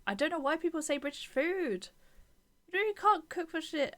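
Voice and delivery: outraged defence of British food; indignant outburst. Real, not acted. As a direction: bristle at the insult. Indignant rant at chat.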